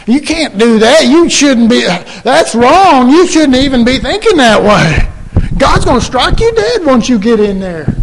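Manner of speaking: high, loud indignant scolding; scolding objection. Acted. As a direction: wag the finger at the rule-breaker, shocked.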